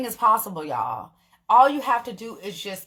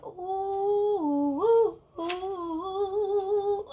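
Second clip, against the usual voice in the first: ecstatic oohs; 10 semitones higher, 5 dB quieter, much slower than usual. Real, not acted. Swooning over Chick-fil-A fries.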